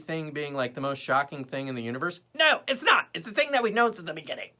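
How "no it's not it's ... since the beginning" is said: high, louder exasperated denial. Real, not acted. Host answers own shocked question, high exasperated outburst. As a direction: burst out as if it were obvious.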